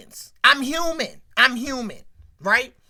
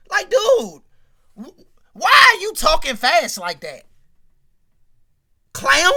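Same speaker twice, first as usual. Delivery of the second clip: louder, exasperated yelling; real annoyed outburst; 3 semitones higher, 7 dB louder than usual. Real, not acted. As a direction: snap at him in disbelief. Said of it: "I'm mad at Stoney".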